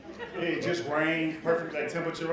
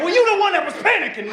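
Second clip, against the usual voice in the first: high, loud mock comeback; loud retort; 10 dB louder than usual. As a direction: fire back at a high shout. Doctor snaps back in argument.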